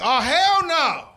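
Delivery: loud, high mock refusal, drawn out; hammed-up outrage. Brooklynite hearing someone is from Waco, hammed-up outrage. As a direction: belt it out as an exaggerated protest.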